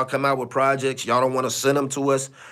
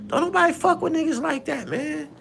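much higher, thinner-sounding voice; high-pitched exclamation; 12 semitones higher, 4 dB quieter than usual. Acted. Host dismissing a troublemaker, high-pitched exclamation. High take, then said again plainly.

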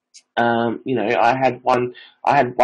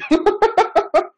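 high laugh. Real, not acted. Laugh after story.

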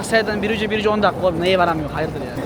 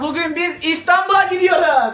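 high, drawn-out excited shout; excited announcement; 10 semitones higher, 4 dB louder than usual. Real, not acted. Vlogger announces the Istanbul trip to viewers, excited shout. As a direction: shout it out, stretching the words.

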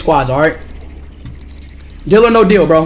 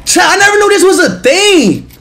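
high, excited telling chat; can't believe it; amazed; 12 semitones higher than usual. Real, not acted. Tells chat of a discovery.

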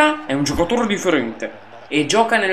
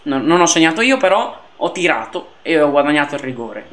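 usual speaking voice

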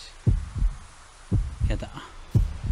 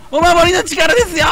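お守りの力ですよ shouted high and loud; triumphant shout; 14 semitones higher, 7 dB louder than usual. Acted. 視聴者へお守りの力ですよと誇らしげに叫ぶ. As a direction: bellow お守りの力ですよ like a hero. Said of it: "これがお守りの力ですよ。".

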